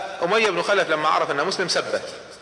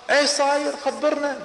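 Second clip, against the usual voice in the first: alarmed question; 9 semitones higher than usual. Acted. Crowd pressing Damdam.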